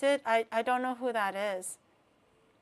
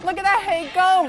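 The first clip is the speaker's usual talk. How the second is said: loud, higher excited yell; excited shout; 8 semitones higher, 12 dB louder than usual. Acted. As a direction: shout it out with glee. Shouted repeat of the name.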